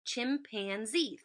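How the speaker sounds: Chimpanzee said slowly as chim, pan, zee; slow, evenly parted Chimpanzee; three syllables. Shown as a demonstration. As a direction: break Chimpanzee into three drawn-out beats. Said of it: "Three syllables".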